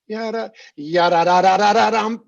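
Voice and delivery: loud growing sung accompaniment; growing crescendo. Shown as a demonstration. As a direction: build the crescendo to the end. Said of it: "your energy needs to grow".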